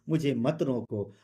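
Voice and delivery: ordinary talk, nothing marked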